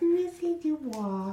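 put-on high, slow little girl voice. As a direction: play a tiny pigtailed girl. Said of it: "my terrible little girl voice".

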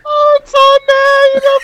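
loud, piercingly high mock killer; hammed-up falsetto squeal; strangely high-pitched voice. Joking as the masked killer, strangely high-pitched. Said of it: "strangely high-pitched voice".

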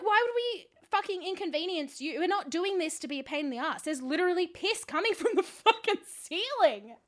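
high, indignant rant; indignant protest. Retold or imitated. Guest arguing with management over the leak, indignant protest. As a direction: argue it hotly, pitch climbing on the outrage. Retold argument with disbelieving staff.

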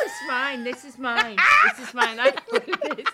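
high, loud protest through laughter; laughing protest. Retold or imitated. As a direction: defend it while cracking up.

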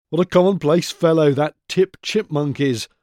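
lightly voiced scornful squirrel; sneering. A narrator's reading. Featherhead scorning Tip Chipmunk, light sneer. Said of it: "sneered Featherhead one day".

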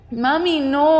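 put-on high, loud child's protest; loud little boy. A narrator's reading. Son refusing to leave with mom, loud childish protest. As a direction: protest like a small child, drawing attention. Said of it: "he said loudly".

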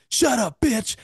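high-pitched mock snap from the crowd; imitating Charlie Murphy again, high and sharp; mock Charlie Murphy yell. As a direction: squeal it out like a heckler. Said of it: "then you just hear Charlie".

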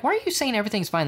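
high-pitched, rapid mock protest; indignant disbelief. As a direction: fire it off in exasperated disbelief. Retelling own indignant challenge to passenger.

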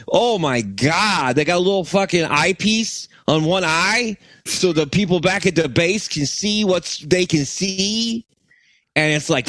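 hammed-up Chicago accent. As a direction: put on a loose Chicago pastiche. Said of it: "Chicago guy".

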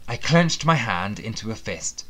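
usual speaking voice

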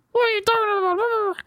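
high muffled voice, like through a wall; high muffled parent voice. Retold or imitated. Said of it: "speaking in a really high, muffled voice".

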